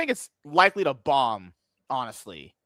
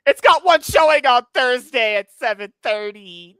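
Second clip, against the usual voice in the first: loud, raised frustrated outburst; frustrated shout; 6 semitones higher, 9 dB louder than usual. Real, not acted. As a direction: blurt it out in annoyance. Frustrated about single showing time.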